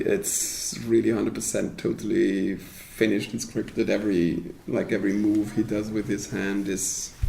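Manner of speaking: ordinary delivery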